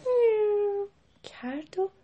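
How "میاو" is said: long, high meow; soft cat meow. A narrator's reading. The cat replies to Mehrabun, long high meow. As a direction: imitate a cat's drawn-out meow. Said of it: "گربه آهسته".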